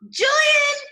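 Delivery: loud high call across the house. Shown as a demonstration. Father calling his son through the house, loud call. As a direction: call the boy's name loudly. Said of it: "he called".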